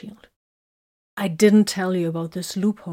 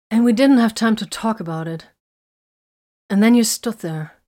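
raised, louder accusation; bitter reproach; 4 semitones higher, 7 dB louder than usual. A narrator's reading. Woman reproaching him for standing there, bitter. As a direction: throw it at them, hurt and bitter. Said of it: "she said bitterly".

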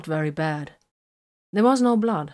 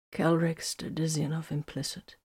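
weak, slurred reply; 8 dB quieter than usual. A narrator's reading. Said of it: "Vox slurred".

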